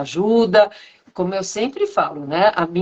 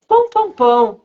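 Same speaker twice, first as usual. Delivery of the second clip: PÃO, PÃO, PÃO tossed out higher, like talking; playful intonation game; 4 semitones higher, 4 dB louder than usual. Retold or imitated. Speech therapy group in a circle, one to another, playful intonation. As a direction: speak only in "pão", with lively raised intonation. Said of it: "coisas com entonações só usando a palavra pão".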